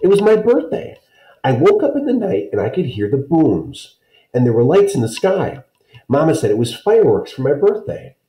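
put-on high voice of a proud small child; little girl voice. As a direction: speak up like an eager five-year-old. Small child Kirsty speaking.

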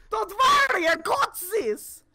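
high, loud call; over-the-top high-pitched assurance; heroic boast. Real, not acted. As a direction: shout it out high, drawing out the end. Hammed-up reassurance in game.

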